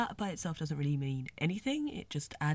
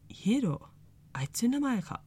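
higher, bright Hiro, quick question; pleased discovery; 7 semitones higher, 3 dB louder than usual. Retold or imitated. Fan voicing Juo learning Hiro's name, lightly pleased. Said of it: "pretty darn pleased".

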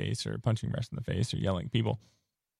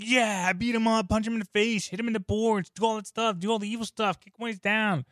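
higher, gleeful cheering from the stands; mock cheering; 10 semitones higher than usual. Wife cheering him on at his hockey game, gleefully high. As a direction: egg him on like a bloodthirsty fan. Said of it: "she was cheering".

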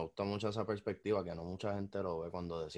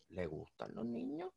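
high, soft, slow sly question; creepy insinuation; 12 semitones higher, 9 dB quieter, slower than usual. Acted. Teasing his friend about kids, a sly creepy whisper-soft question. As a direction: lean in and ask it like a dirty hint.

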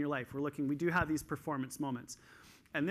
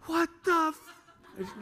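much higher and louder, slow, laughing disbelief; mock-incredulous, as if the coach has lost it; baffled reaction; 8 dB louder, much slower than usual. Twelve-year-old swimmer reacting to her overhyped coach, laughing disbelief. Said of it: "And she's like".

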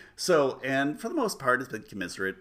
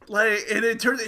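normal talking voice

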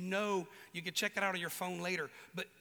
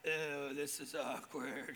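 low, soft, slow embarrassed mumble; sheepish and quiet; awkward aside; 6 semitones lower, 6 dB quieter, much slower than usual. Retold or imitated. Thomas caught doubting, slow, soft awkward mumble. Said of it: "Thomas is like".

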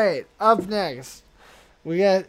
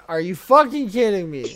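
retold outrage; 3 semitones higher, 7 dB louder than usual. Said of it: "and I'm like".

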